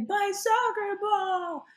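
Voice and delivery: put-on high, slow sing-song wail of a little boy; wailing toddler. Mother imitating her toddler wailing for his ball. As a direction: draw out the cry like an upset child. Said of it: "And he's like".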